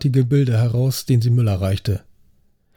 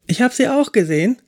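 put-on much higher, eager young officer backing him up; affirming trainee; 10 semitones higher than usual. A narrator's reading. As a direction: insist eagerly to regain control. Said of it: "bekräftigte Schulze Weißenhaupt".

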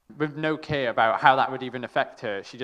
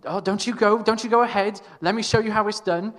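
imitating mum, pitched much higher and louder; hammed-up motherly take-charge voice; mum's bossy warning; 10 semitones higher, 7 dB louder than usual. Imitating mum telling the kids she'll show them how. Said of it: "my mum was like".

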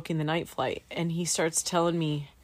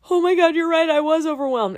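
high, loud burst of realizing; overwhelmed realization; 10 semitones higher, 7 dB louder than usual. Retold or imitated. Student admitting to instructor she was overwhelmed, high loud outburst. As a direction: re-enact the moment it hits you, pitched way up.

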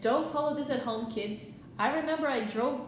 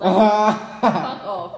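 loud laughing burst; 3 semitones lower, 11 dB louder, much slower than usual. Real, not acted. Laughing to her friend about her perfect boyfriend. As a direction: laugh it out, embarrassed and delighted.

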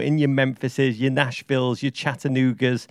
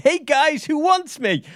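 much higher, louder showman call; hammed-up cheery greeting; 14 semitones higher, 4 dB louder, slower than usual. Bursting into an empty bar, greeting imagined fans with a high showman call. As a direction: burst in like a star expecting fans.

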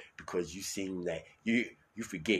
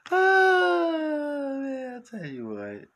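high, crying-laughing words; 8 dB louder, much slower than usual. Real, not acted. Host recovering from laughter, high and wheezing. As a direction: talk through the last of the laugh.

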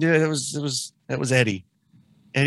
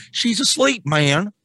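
a higher quoted voice; imitating Nick; 9 semitones higher, slower than usual. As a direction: quote him in a higher pitch. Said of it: "In your own words".

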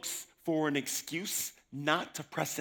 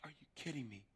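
hushed disbelief; 17 dB quieter than usual. Retold or imitated. Disbelief at praise.

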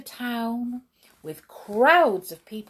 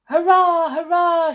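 high, loud hurrahs; shouted cheer; 10 semitones higher, 10 dB louder than usual. A narrator's reading. Skip celebrating the getaway, triumphant shout. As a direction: cheer at full voice. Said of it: "shouted Skip".

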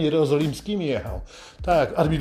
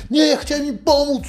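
high, louder innocent protest to a cop; pleading innocence to the policeman; fake innocent excuse; 12 semitones higher, 5 dB louder than usual. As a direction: play the helpful citizen caught out. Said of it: "to powiesz".

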